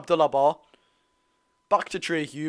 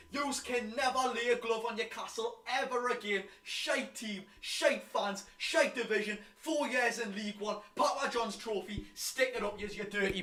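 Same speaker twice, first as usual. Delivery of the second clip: distant taunting; 18 dB quieter than usual. Real, not acted. Taunting rivals away from microphone.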